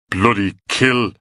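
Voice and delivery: slow, slurred, battered swearing; slurred curse. A narrator's reading. Beaten Graham cursing his attackers, slow and slurred. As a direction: curse through a broken face. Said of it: "The words won't come out right".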